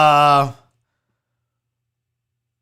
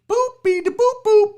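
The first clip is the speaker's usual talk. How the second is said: high beeps; 13 semitones higher, 4 dB louder, slower than usual. Pretend. Sound effect while sharing screen.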